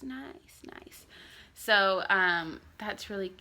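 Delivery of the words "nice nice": breathy whispered Nice, nice, soft and tender; whispered baby nice. Retold or imitated. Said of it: "He goes".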